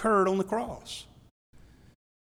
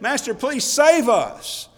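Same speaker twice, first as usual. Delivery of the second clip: louder, higher, slow cry for rescue; imitated desperate plea; 6 semitones higher, 7 dB louder, slower than usual. Disciples beg Jesus amid the waves, crying out. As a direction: call out high and stretch the plea.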